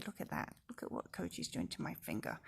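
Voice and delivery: low, quick murmur to the puppy; hushed aside. Real, not acted. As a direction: mutter it under your breath. Aside to puppy chewing finger.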